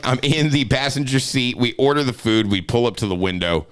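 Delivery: ordinary talk, nothing marked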